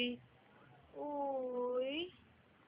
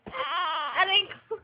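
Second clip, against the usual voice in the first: loud, higher yelp of pain; pained outcry; 4 semitones higher, 11 dB louder, slower than usual. Real, not acted. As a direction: cry out as if suddenly hurt. Baby hurts the parent.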